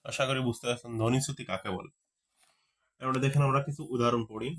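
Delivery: normal talking voice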